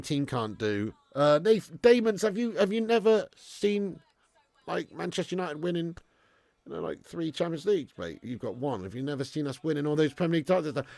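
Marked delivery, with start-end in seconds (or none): none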